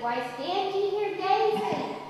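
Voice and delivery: high, slow, solemn question; angel's question. Acted. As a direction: call down like an angel. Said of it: "And the angel said".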